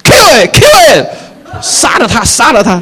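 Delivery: put-on high, loud kid yelling at a video game; gaming son yelling. Son shouting at his video game, high and loud. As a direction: shout like a boy lost in a game. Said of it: "儿子呢，在那边说".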